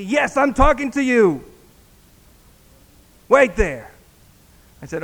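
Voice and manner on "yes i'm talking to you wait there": loud, high-pitched yell; retold yell. Husband yells at the departing pastor, retold yell. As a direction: imitate a man yelling after someone. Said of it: "yelled at me".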